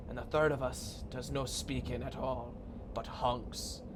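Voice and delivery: slow, archaic pronouncements; a measured, slightly portentous game character; lightly voiced solemn riddler. Game figures speak in archaic style.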